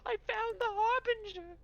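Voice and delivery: very high, rapturous creature voice; fanatical hyena man. Acted. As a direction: proclaim it in a high zealous squeal. Plays the hyena man Tickle.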